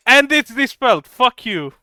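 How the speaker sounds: loud gloating victory yell; triumphant shout. Real, not acted. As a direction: crow over the win. Triumphant spell dispel in game.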